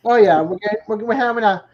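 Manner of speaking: loud and high, tipsy; excited. Real, not acted. Excited reply to getting drunk.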